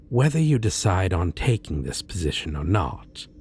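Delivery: ordinary talk, nothing marked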